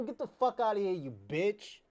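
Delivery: ordinary talk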